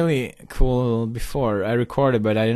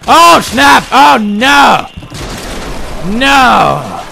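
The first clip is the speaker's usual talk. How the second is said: loud, high cries of dismay; alarmed shouting; 12 semitones higher, 10 dB louder, much slower than usual. Real, not acted. Gamer watching his run go wrong, shouting in alarm. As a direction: yell as it all goes wrong.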